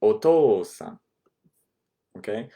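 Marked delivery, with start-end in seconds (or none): 0.0-1.0 teacher's model word; clear model word. Shown as a demonstration. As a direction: say "Otou-san" loud and clear, long o, falling end. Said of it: "use the more polite otou-san".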